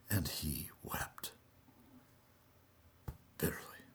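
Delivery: soft, whispered remembrance; hushed whisper. A narrator's reading. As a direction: sink into a grieving whisper. Peter remembers and weeps.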